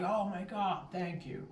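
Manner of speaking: high, relieved woman's voice; grateful lady. Retold or imitated. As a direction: gush thanks like the owner.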